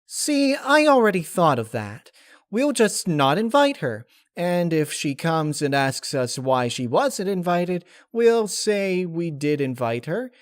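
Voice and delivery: high, loud and gleeful; giggly scheming. Acted. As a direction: plot it with a grin. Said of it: "Pinkie Pie giggled".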